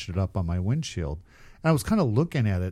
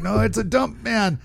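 higher, amused car wash worker; car wash guy mocking, raised pitch; laughing car wash guys; 10 semitones higher than usual. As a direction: tease him, pitched up and laughing. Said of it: "All the Mexican guys are, like, laughing".

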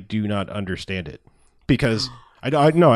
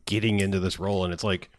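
ordinary delivery

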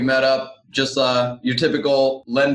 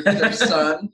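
high surprised realtor voice, drawn-out; imitating surprised agent; 4 semitones higher than usual. Realtor reacting to the baby, mock surprised and high. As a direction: play the startled open-house agent. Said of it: "they're like".

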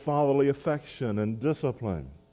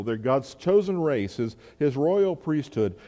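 normal talking voice

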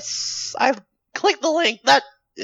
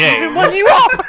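high, loud, laughing quote; amused incredulity; 8 semitones higher, 8 dB louder than usual. Retold or imitated. Co-host throws the reader's line back, laughing incredulity. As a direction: repeat it loudly, cracking up.

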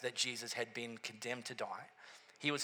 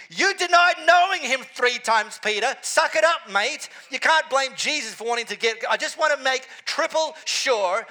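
much louder and higher, hammed-up telling-off; mock scolding shout; 11 semitones higher, 14 dB louder than usual. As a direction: bellow at Peter to suck it up, half joking.

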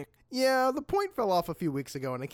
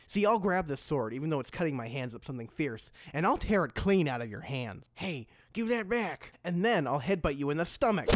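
ordinary talk

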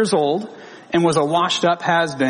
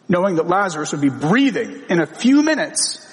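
ordinary talk, nothing marked

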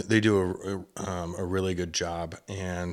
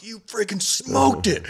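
much higher and louder, slow and stretched out; thrilled yell; 5 dB louder, slower than usual. Retold or imitated. Telling the hunter she hit the doe, thrilled high yell. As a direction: shout the praise at the top of your voice. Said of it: "I was so freaking excited".

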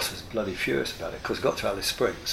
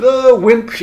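high, loud mocking jeer; a loud high jeer at the one who gave up; jeering taunt; 12 semitones higher, 13 dB louder than usual. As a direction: taunt him like a rowdy mate. Mates jeering at the drinker.

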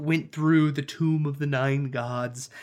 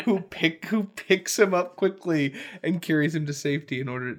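usual speaking voice

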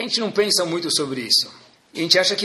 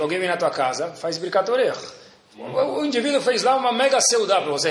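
usual speaking voice

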